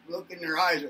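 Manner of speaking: ordinary talk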